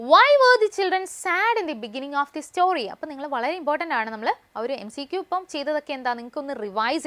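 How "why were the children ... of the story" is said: high, loud and very slow; teacher reading an exam question slowly. Shown as a demonstration. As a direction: dictate the question to the class. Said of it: "reading the exam question aloud".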